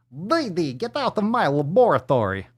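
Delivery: high, put-on cartoon boy-genius voice; Dexter impression. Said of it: "Dexter's l".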